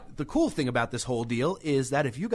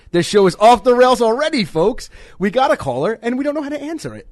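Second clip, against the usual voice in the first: excited exclaiming; 7 semitones higher, 8 dB louder than usual. Real, not acted. Live call fails, host exclaims.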